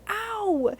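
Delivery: high, loud cry of pain. A narrator's reading. Percy cries out when the trucks bump him. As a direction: yelp at the sudden bump.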